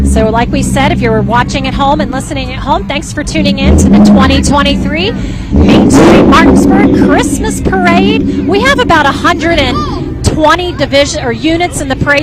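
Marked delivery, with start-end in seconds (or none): 1.0-11.2 announcer. Real, not acted. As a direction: announce the parade proudly. Host reopens parade broadcast.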